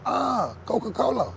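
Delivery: high "Ah, Coca-Cola" in mock realisation; mock waiter's realisation. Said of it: "they go".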